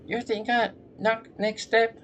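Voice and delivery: very high, thin man's voice; high-pitched man voice. Acted. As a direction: squeak it up high. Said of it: "high-pitched man".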